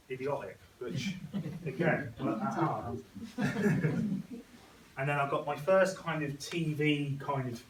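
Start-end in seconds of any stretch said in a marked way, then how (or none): 3.1-4.6 high rising laugh at himself; real laugh. Real, not acted. Laughing at his own joke about playing the idiot, real laugh. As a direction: laugh it off, amused at yourself.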